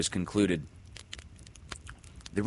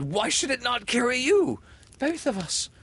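high, loud excited voice; eager cry; 5 dB louder than usual. Acted. Peter crying out to Windy with new hope. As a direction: burst out with hope. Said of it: "he cried".

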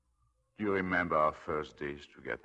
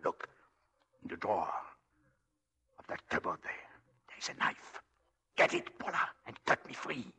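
urgent whisper; 4 semitones higher than usual. Acted. Urgent whispered plea, unvoiced.

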